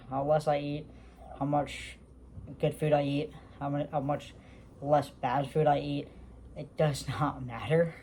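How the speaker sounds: usual speaking voice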